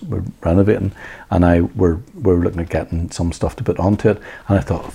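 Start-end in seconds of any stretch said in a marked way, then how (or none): none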